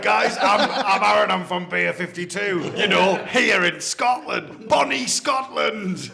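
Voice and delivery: mock Scottish brewer greeting the camera, rising to a shout; playful fake Scottish accent. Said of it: "a Scottish accent, my Beer fifty-two one".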